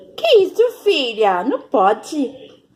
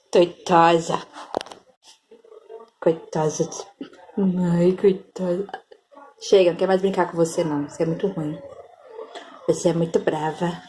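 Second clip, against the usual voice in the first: much lower, grumbly pet voice, whispered at times; grumpy cat voice; 13 semitones lower, 4 dB quieter, faster than usual. Pretend. Mother voicing her grumpy cat, deep and sulky.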